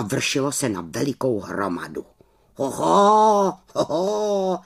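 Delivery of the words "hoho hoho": drawn-out astonished bird call; high, put-on raven exclamation; exclaiming raven. A narrator's reading. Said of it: "zvolal Abraxas".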